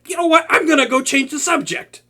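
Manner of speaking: insist, still rattled; flustered. Acted.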